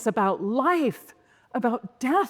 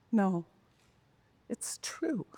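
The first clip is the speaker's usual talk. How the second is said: soft, hushed reply; a calm voice from the darkness; quiet inner voice; 4 semitones lower, 8 dB quieter than usual. Retold or imitated. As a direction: let it fall to a breathy hush. Inner voice answers her hope.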